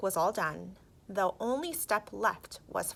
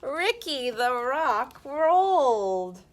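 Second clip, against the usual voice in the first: slow, drawn-out triumphant reveal; slow build; 5 semitones higher, 3 dB louder, slower than usual. A narrator's reading. Narrator revealing Rick finally rolls, slow triumphant. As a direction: stretch it out for the big moment. Climax of story.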